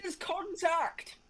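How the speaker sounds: drawn-out, high cry of alarm; excited commentary call. Real, not acted. Race commentator spotting a collision, excited. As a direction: call the crash high, stretching it out.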